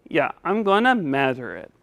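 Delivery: "measure" pronounced "matter"; lisping puppet. Pretend. Puppet confirming to teacher, "measure" said as "madder". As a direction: say "measure" with a T or D in the middle. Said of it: "help you say your S".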